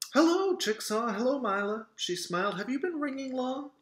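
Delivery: mom voice. Acted. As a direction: greet them as a cheerful mom. Mother greets kids.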